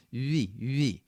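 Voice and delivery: U said as a rounded French vowel; French U sound. Shown as a demonstration. Coach shows the French U for the R. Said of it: "like a French sound".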